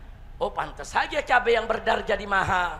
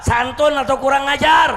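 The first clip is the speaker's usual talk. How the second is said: loud, raised rhetorical question; shouted challenge; 4 semitones higher, 12 dB louder than usual. Real, not acted. Preacher asking congregation to judge officials, shouted challenge. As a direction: fire the question at the crowd.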